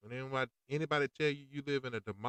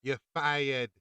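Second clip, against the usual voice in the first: quoting Trump; 6 dB louder than usual. Retold or imitated. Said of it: "He used to say".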